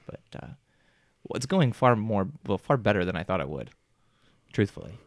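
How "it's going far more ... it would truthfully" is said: ordinary delivery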